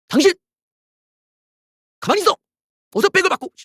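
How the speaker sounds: high, loud order to the wife; mock husband yell. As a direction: bark it at the wife like the boss. Voicing the husband scolding his wife.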